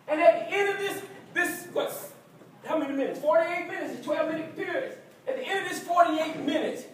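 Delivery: loud, rising fired-up team speech; halftime pep speech. Acted. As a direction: pump the players up before the game.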